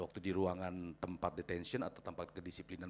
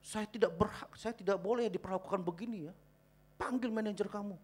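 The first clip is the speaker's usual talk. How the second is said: higher-pitched mock-indignant customer; complaining customer, acted without full yelling; retold irate complaint; 11 semitones higher, 5 dB louder than usual. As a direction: imitate a woman demanding the manager. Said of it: "ada ibu-ibu teriak".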